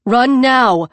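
loud, high urgent cry; urgent shout. Acted. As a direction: yell at her to run. Fleeing agents, urging escape.

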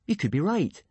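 sudden hopeful lift; eager. A narrator's reading. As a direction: seize on the idea eagerly. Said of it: "he said eagerly".

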